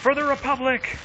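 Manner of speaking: high, playful rallying shout; battle cry. Pretend. Streamer playing at war mid-match, high rallying cry.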